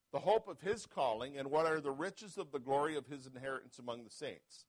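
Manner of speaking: rising to a loud preaching peak on the Lord's name; scripture reading. Acted. Pastor reading Ephesians to the church, preaching. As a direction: read the verses as a building sermon.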